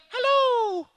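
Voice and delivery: high-pitched drawn-out hello; high call-out. Shown as a demonstration. Magician gets the audience to say hello, high call-out. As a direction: sing it up high for the crowd to copy.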